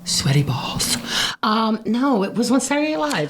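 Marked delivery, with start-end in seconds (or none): 0.0-1.0 low unvoiced whisper. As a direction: breathe it out low. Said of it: "they talk like this".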